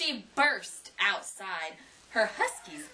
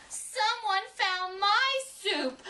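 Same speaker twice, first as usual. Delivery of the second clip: much higher, slow little bear squeak; high squeaky Baby Bear; 11 semitones higher, slower than usual. A narrator's reading. Baby Bear complains to her family in a high squeak. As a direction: squeak it out like a tiny cub. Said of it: "in her high, squeaky voice".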